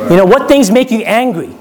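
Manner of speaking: usual speaking voice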